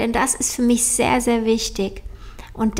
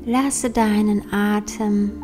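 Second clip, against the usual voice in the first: slow, soft meditation voice; guided meditation; 5 dB quieter, slower than usual. Acted. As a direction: guide them gently into calm, slowly.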